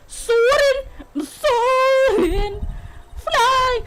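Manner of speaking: high, drawn-out theatrical cry; mock wail. Bride calls out for her husband, in a mock wail. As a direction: wail the name out melodramatically.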